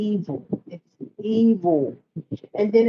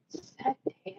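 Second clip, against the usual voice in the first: whispered; 11 semitones higher, 13 dB quieter than usual. Real, not acted. Grieving over stabbed girl.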